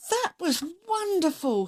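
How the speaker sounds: a touch of a thrilled fox; delighted fox. A narrator's reading. Fox praising Stick after his song, mildly delighted.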